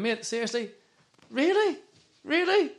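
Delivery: a pointed, exasperated really, really; incredulous retort. Retold or imitated. Turning round to the moaning fan, exasperated disbelief.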